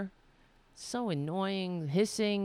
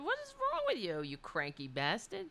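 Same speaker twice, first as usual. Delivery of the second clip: much higher mock voice, dropping at the end; cat's voice; 5 semitones higher, 3 dB quieter than usual. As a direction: squeak it for the cat, then sour. Voicing cat's thoughts.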